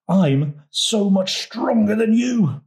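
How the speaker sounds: slow, high boastful toad; bragging. A narrator's reading. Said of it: "bragged Toad".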